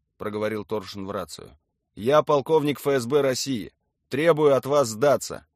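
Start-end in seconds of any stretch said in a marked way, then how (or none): 2.0-5.5 loud, raised official demand; forceful colonel on the radio; commanding. A narrator's reading. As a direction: announce yourself and demand surrender.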